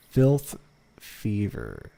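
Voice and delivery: normal talking voice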